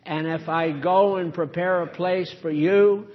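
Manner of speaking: solemn Bible reading; scripture reading. Acted. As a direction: read the gospel to the congregation. Reads scripture aloud.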